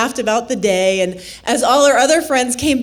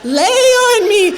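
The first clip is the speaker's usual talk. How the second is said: mock siren call, louder and higher at the end; rock calling out; 4 semitones higher, 3 dB louder than usual. Flat rock beckons tired hiker, playful calling. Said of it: "rock that kinda called out to me".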